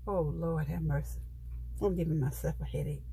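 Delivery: ordinary delivery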